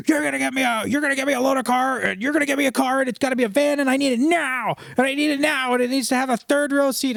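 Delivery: high, pushy mock of the customer; demanding customer. Salesman imitates customer wife's demands, high and pushy.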